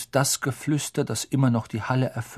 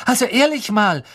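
much higher, louder exasperated Hermine; indignant; 11 semitones higher, 6 dB louder than usual. Acted. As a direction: huff in disbelief at the boys. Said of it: "sagte Hermine entrüstet".

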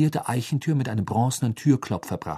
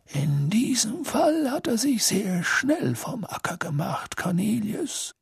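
calm; 13 semitones higher, slower than usual. Acted. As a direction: say it gently and unruffled. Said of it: "sagte Dumbledore ruhig".

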